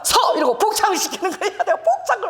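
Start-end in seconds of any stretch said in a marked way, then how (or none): 0.0-0.4 loud, high one-word bark; sharp command. Retold or imitated. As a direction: snap her to attention. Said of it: "내가 잘못하면".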